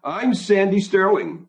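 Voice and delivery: high, slow female character voice; woman's introduction. A narrator's reading. Sandy introduces herself to the narrator, in a high, slow voice. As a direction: introduce yourself in a high, drawn-out voice.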